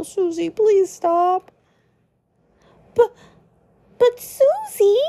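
high pleading voice; pleading Lancer. Acted. Lancer begging Susie to stop, pleading high.

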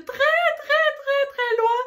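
high, loud, insistent gush; gushing emphasis. Real, not acted. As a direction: gush over your clear favourite. Gushing over personal favourite, Italian.

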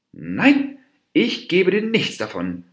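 high, loud, heated refusal; angry snarl. A narrator's reading. Dwarf deer refuses the monkey any bananas, snarling angrily. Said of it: "fauchte der Zwerghirsch".

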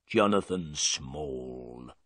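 low and slow, a sullen prisoner naming himself; drawn out, low and grim. Acted. Prisoner answering Holmes, low and slow. Prisoner giving his name.

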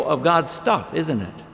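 ordinary talk

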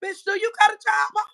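high, loud imitation of the rival's question; mock shout. Mimicking the rival asking about a job, mock shout. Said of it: "Tell me".

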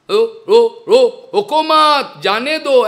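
loud stutter on "रो", stretched out "रोको मत"; stuck on the first syllable, repeating it loudly; imitated stammer. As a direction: play a stammering master shouting down. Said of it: "stammering है".

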